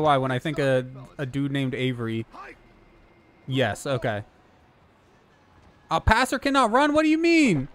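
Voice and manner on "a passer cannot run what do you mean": high, disbelieving question; surprised. Real, not acted. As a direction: blurt it out in shock. Shocked at the game rule.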